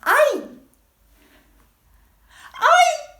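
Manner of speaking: very high, loud "I" yelped in pain; painful long I. Shown as a demonstration. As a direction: cry out "I" like "eye", as if hurt. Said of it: "It's very painful".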